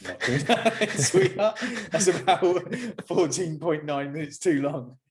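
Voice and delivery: cheeky put-down to the nurse; high sassy mock voice; mock sassy reply.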